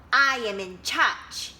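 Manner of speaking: loud, puffed-up declaration; proud and bossy. Acted. Teacher reading Duck to children, loud and proud. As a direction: announce it like you own the pond.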